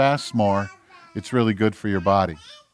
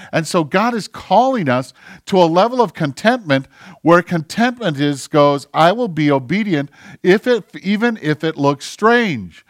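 higher and louder, pressing sermon voice; full-force preaching; 7 semitones higher, 6 dB louder than usual. Acted. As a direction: pour it out with rising fervour.

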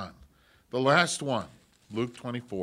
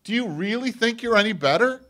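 loud, much higher rebuke; forceful challenge; 10 semitones higher, 11 dB louder than usual. Acted. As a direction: hammer the question home. Jesus challenging, repeated louder.

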